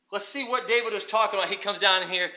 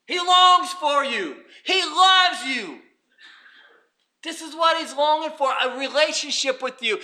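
激昂讲道; 9 semitones higher, 8 dB louder than usual. Real, not acted. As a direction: preach it with rising fervor. Preaches at full force.

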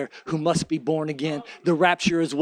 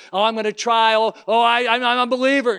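higher, louder mock of a trying believer; mock straining believer; 6 semitones higher, 6 dB louder than usual. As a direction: parody someone forcing faith. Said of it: "Not that I'm gonna press through".

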